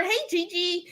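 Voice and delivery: ordinary talk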